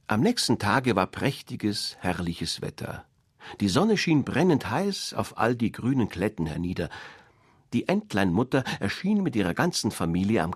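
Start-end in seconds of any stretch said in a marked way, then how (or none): none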